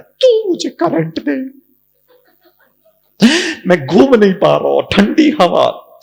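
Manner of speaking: high, pleading mock husband voice; husband as a fan. Husband demanding current from his wife, high and whiny. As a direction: whine like a fan starved of current. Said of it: "पति ... कहता है".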